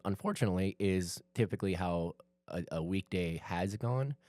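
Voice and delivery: normal talking voice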